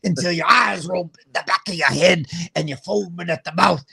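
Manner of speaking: lightly voiced wrestler teacher growl; a hint of a wrestler snarling at kids; Macho Man teacher. Dad mocking the kindergarten teacher to his daughter, lightly voiced wrestler growl. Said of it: "was she like".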